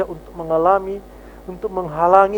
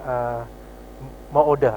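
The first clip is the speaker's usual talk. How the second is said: gentle polite waiter; 5 semitones lower, 5 dB quieter than usual. Retold or imitated. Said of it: "Wah, dengan lemah lembut".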